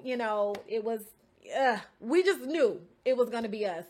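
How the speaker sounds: normal talking voice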